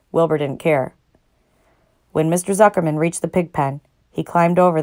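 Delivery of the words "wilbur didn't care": ordinary delivery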